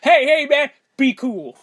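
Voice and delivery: hammed-up be cool; mock calming plea. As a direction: play the nervous guy talking him down. Co-host acts a calming plea.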